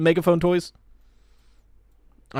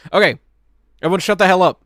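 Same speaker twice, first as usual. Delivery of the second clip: loud; 5 semitones higher, 7 dB louder, faster than usual. Real, not acted. Demands silence for the trailer.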